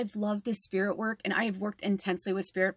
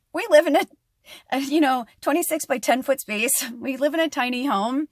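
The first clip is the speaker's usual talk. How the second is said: raised, loud exasperation in retelling; exasperated complaint; 4 semitones higher, 5 dB louder than usual. Retold or imitated. Woken partner protesting noise in tiny home, loud exasperation. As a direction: pitch it up and protest loudly.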